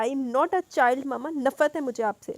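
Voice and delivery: slow, loud tearful shout at her mother; crying shout. Acted. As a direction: yell it through tears, slowly. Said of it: "روحا نے روتے ہوئے چیخ کر کہا". (روتے ہوئے چیخ کر، آہستہ اور بلند)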